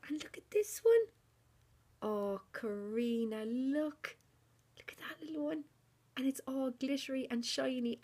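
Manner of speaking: high, thrilled gushing; excited gushing. Real, not acted. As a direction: gush over a tiny gift. Another gift revealed.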